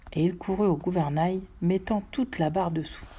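ordinary delivery, nothing marked

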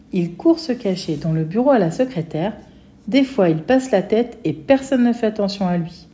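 usual speaking voice

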